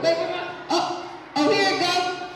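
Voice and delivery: ordinary talk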